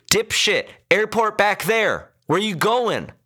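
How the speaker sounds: high, furious yelling at the driver; shouting. A narrator's reading. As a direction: yell insults in exasperation. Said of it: "the man shouted".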